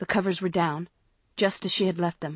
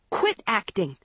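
sharp, restrained outcry; mild shriek; 3 semitones higher than usual. A narrator's reading. Girl at sister feigning sleep, mild shriek. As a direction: shriek it, held back a little. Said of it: "Maggie shrieked".

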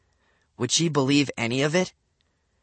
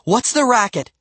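high, loud demand; exasperated cry; 11 semitones higher, 7 dB louder than usual. A narrator's reading. Mom bursts in on noisy kids, crying out loudly. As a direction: cry out over the din. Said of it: "she cried".

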